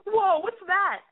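high-pitched, startled blurt; tactless startled guy. Acted. Guy spotting her scar, blurting in high-pitched shock. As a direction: act out a guy's tactless shock. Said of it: "a little bit tacky and tasteless for him to be like".

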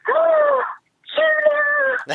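very high, loud squeal of joy; squealing delight. Retold or imitated. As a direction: shriek her name like a starstruck fan. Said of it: "she goes".

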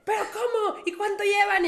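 high, loud retold questioning; flabbergasted father. Dad questions both daughters about boyfriends, flabbergasted. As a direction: demand answers in comic shock. Papá atónito interroga a las hijas.

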